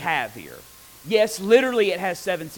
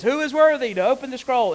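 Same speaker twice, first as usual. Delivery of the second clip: angel's call, higher and louder; 8 semitones higher, 6 dB louder than usual. Retold or imitated. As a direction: cry it out like a herald. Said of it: "an angel says".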